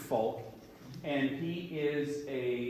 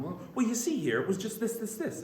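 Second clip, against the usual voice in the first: suspect explaining; 7 semitones higher than usual. Retold or imitated. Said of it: "he would just ask questions".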